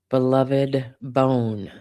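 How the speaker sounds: usual speaking voice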